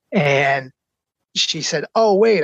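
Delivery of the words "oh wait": higher, louder retold interjection; imitating a sudden afterthought; sudden remembering. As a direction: break in as if just remembering. Retells her suddenly remembering her children.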